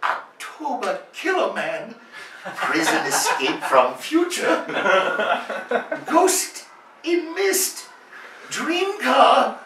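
put-on high, slow, dreamy-excited titles in a Japanese accent, laughing; Japanese accent impression. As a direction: reel off each title like a treasure, in a Japanese accent. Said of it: "he rattles off the absurd names".

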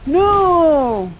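high, loud repeated wail; desperate no. Real, not acted. Streamer yells at the game as she falls, desperate. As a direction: plead with the game as it fails.

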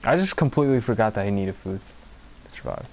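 ordinary talk

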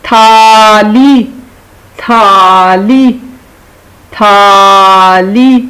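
very slow تھالی with a breathy th; aspirated th. Shown as a demonstration. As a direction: stretch تھالی, push air on the th. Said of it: "یہ بن جائے گا تھ". (تھالی بہت آہستہ، تھ پر سانس کے ساتھ)

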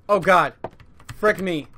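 high, loud panicked yell; scared shout. Real, not acted. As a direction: shout in real fright. Monster appears.

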